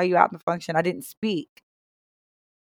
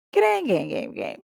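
whispered; 7 semitones higher, 7 dB quieter than usual. Acted. Drops to whisper.

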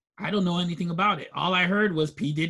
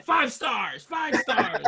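higher-pitched, playful reviewer's cheer; mock enthusiastic rating; 6 semitones higher, much slower than usual. Podcast host imitating a thrilled audience leaving reviews, mock-excited cheer. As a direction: call it out like a delighted listener, jokingly. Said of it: "You're gonna be like".

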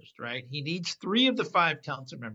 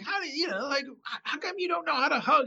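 mock yelling; 9 semitones higher than usual. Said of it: "he's yelling at him".

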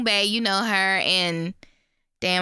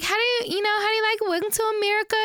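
high, sweet, overly polite voice; butter up the mother in a bright high voice; playing the nervous daughter, high and sweet; sugary daughter voice; 11 semitones higher, much faster than usual. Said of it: "she is just talking to the mama like".

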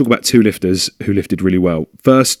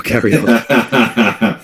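laughing; 2 semitones higher, much slower than usual. Real, not acted. Laughs after hearing kid scream.

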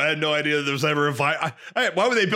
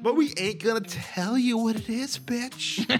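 sassy; 7 semitones higher, 3 dB quieter than usual. Acted. Sassy outro voice.

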